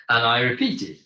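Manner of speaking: ordinary talk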